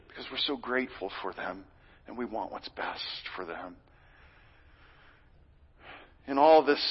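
normal talking voice

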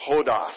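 "Hodos" spoken in unison, falling; saying hodos together. Shown as a demonstration. Pastor leading the congregation in saying "Hodos". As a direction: chant "Hodos" with the room. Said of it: "say it one more time".

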